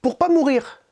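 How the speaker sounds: high, lightly voiced scheming princess; a hint of a young princess thinking aloud; princess's inner voice. Princess plotting to dodge her death, lightly voiced high. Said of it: "Et là, elle se dit".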